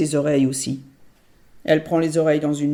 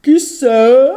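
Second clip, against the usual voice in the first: much higher, loud odd voice; ogress as granny; 7 semitones higher, 12 dB louder than usual. Pretend. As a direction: fake granny badly. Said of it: "Tu as une drôle de voix". (drôle de voix de mémé)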